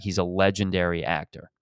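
ordinary talk

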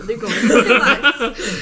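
mock scream dissolving into laughter; retold scream. Retelling a woman's scream to a co-host, loud and laughing. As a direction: raise the volume and stretch it, laughing through. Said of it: "She screamed".

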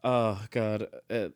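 ordinary talk, nothing marked

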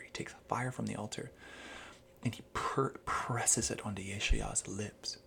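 soft, near-silent whisper; hushed whisper. Acted. Describing the seraph at the altar, whispered in awe. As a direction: breathe it out with awe. Hushed retelling of holy moment.

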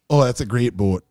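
mock Minnesotan with exaggerated vowels; Minnesotan accent. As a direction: play an unsuspecting Minnesotan, accent thick. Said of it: "They'll be like".

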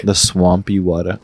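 ordinary talk, nothing marked

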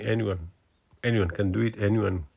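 usual speaking voice

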